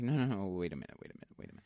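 mock halting protest, trailing into breath; retold protest. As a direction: rattle it off, fading to a near whisper. Retold protest at the counter, fading.

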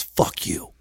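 voiceless imitation of mouthing; mouthed curse. Girl on platform to a stranger, mouthed silently. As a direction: mouth it with barely any sound. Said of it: "she kinda mouths".

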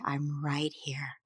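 slow, hushed, near-whispered comfort; quiet reassurance. Acted. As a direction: reassure very softly and low. Parent quietly reassures screaming child.

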